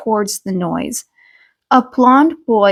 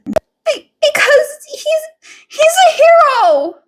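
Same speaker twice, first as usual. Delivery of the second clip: put-on much higher, stammering child voice; a nervous kid insisting on his hero; timid boy defending; 4 dB louder than usual.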